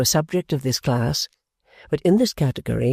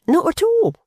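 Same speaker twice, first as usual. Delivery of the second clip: Pancks; 4 dB louder than usual. Acted. Pancks voiced high.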